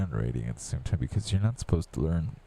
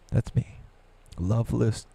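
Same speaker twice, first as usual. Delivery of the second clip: normal talking voice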